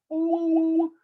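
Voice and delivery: high hummed note muffled by hand; hand over mouth. Shown as a demonstration. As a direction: hum high through a covered mouth. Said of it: "hum one note and put my hand over my mouth".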